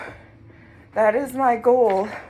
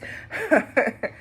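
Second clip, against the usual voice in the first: light laugh. Real, not acted. Laughs about expletives.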